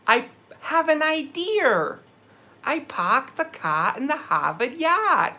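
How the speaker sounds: high, slow mock Boston accent. Outsiders mocking his New England roots, high slow Boston accent. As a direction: drop every R like an outsider faking Boston. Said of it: "find out I'm from New England".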